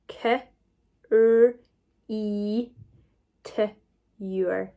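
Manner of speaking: K, R, E, T, YOUR as isolated sounds; sounding out. Shown as a demonstration. Teacher modelling individual sounds, K, R, E, T, YOUR one by one. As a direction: give each sound K, R, E, T, YOUR alone, pausing between. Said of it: "let's sound it out together".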